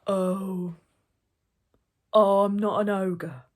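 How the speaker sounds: drawn-out, loud ogre voice; slow ogre. A narrator's reading. Slow ogre denies being one to gatekeeper, drawling. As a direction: stretch out each word as a slow ogre.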